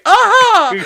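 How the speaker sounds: loud high gotcha exclamation; triumphant aha. Acted. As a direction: shout it out like you caught someone. Mock villain exclamation.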